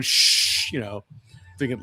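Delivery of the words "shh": imitated sharp shush. Mom keeping her kid away from a sleeper, shushing. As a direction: hush a child with a long shh.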